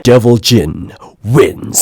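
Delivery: slow, deep announcer voice; game announcer. Retold or imitated. Mimicking the game calling his loss, deep announcer voice. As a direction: imitate a fighting game announcer, low and drawn out. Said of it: "and it's like".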